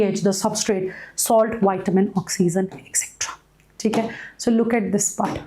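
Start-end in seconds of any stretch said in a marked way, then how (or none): 2.7-3.4 whispered, trailing off; whispered aside. Real, not acted. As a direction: drop to a whisper. Trailing aside while listing items.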